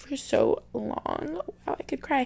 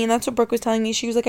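normal talking voice

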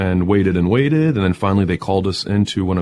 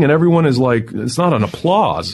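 ordinary delivery

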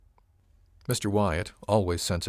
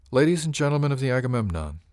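higher, louder opening to a speech; formal address; 6 semitones higher, 5 dB louder than usual. A narrator's reading. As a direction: announce it to the whole room. Captain opens a formal address.

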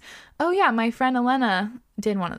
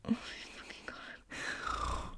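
whispering; 17 dB quieter, slower than usual. Real, not acted. Reacting in shock to gross story.

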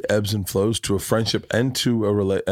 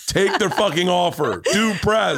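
retold emphatic shout; 7 semitones higher, slower than usual. Retold advice to Tom's wife.

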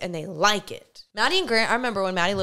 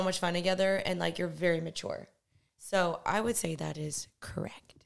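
soft intimate whisper; 2 semitones lower, 8 dB quieter than usual. Real, not acted.

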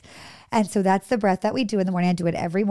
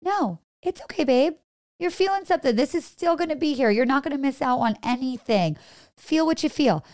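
put-on higher, gentle reassurance to a small kid; soothing a child; 6 semitones higher than usual. Teacher comforting a crying toddler in circle time, higher and tender.

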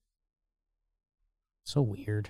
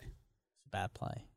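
whispered; 4 semitones lower, 16 dB quieter than usual. Real, not acted. Repeating his verdict under breath.

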